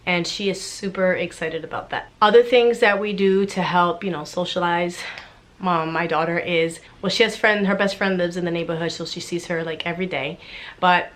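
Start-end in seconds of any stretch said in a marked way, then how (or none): none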